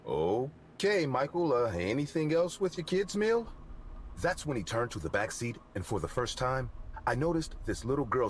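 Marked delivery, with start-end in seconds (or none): 0.1-3.5 awkward. Acted. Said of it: "I said in an awkward voice".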